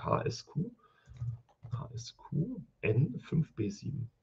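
muttering to myself. Real, not acted. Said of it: "Warum ich mir die sage?".

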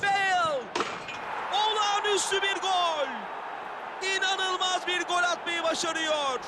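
screaming football commentator. Acted. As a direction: yell the goal call at full pitch. Goal scored in football commentary.

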